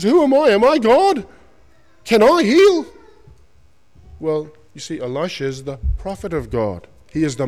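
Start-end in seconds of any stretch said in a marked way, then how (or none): 0.0-2.9 high, loud anguished king crying out; despairing cry. Acted. King tears clothes in despair.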